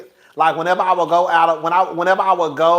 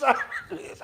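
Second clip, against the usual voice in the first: ordinary talk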